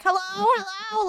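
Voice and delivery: high, drawn-out put-on hello; silly trolling voice. Trolling rude kids online, high silly drawl. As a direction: call out slowly in a silly high voice. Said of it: "Drew doing a voice and me being like".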